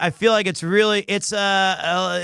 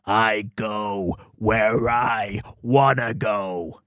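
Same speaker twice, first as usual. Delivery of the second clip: very low, drawn-out tough-guy voice; Wolverine; 9 semitones lower, much slower than usual. Acted. Player trying a cartoon hero's voice for the samurai, low and slow. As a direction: drag each word out deep and slow. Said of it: "give him the Wolverine's voice".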